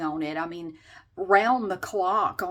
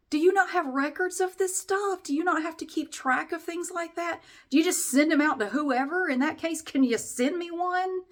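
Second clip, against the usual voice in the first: sarcastic rant; 7 semitones higher than usual. Retold or imitated. Ranting at the absent agent.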